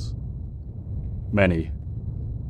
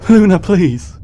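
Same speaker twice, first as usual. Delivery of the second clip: high, loud, pleading cry; desperate shout; 10 semitones higher, 9 dB louder, slower than usual. Acted. Celestia begging her pursuing sister, high desperate shout. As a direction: shout to stop a sister turned monster. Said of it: "Celestia shouted at her pursuer".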